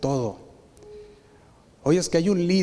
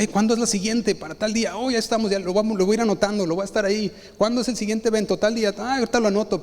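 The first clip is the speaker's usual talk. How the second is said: eager members; 8 semitones higher, faster than usual. Retold or imitated. Voicing eager church members.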